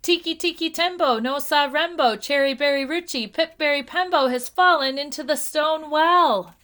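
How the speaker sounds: high, loud urgent calling; shouting. A narrator's reading. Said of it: "shouted Chang".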